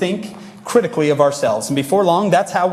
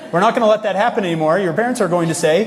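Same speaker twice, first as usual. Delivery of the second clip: normal talking voice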